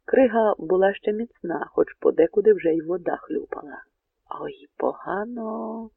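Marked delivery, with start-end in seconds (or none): 4.4-5.9 soft, drawn-out worried murmur, pitched up; worried inner thought. A narrator's reading. Bohun frets to himself over the splashing ice, hushed worried thought. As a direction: think it slowly under your breath. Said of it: "подумав Богун".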